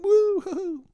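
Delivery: high squealing woo-hoo; kids cheering. Retold or imitated. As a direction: squeal like excited little kids. Little cousins cheering in kayak.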